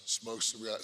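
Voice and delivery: normal talking voice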